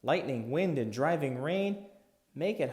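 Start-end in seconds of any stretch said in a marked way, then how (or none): none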